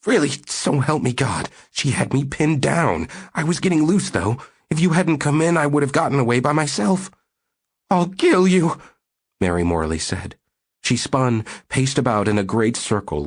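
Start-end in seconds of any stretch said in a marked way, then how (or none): 0.1-7.1 higher, pleading, rushing at the end; imploring. Acted. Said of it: "he said imploringly". 7.9-8.8 much higher and louder, raging; furious threat. Acted.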